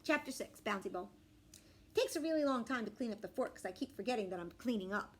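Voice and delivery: plain reading voice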